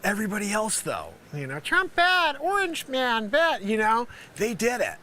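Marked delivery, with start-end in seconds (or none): 1.6-3.6 high, slow mock chant; mocking parrot voice. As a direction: mimic them in a dumb, high voice. Mimics the media's simplistic chant.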